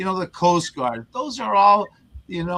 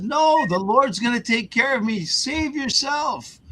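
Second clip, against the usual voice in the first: stubborn priest; 9 semitones higher than usual. Retold or imitated. Priest refuses help in joke.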